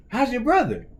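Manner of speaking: high-pitched coach imitation; coach's voice. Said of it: "he says".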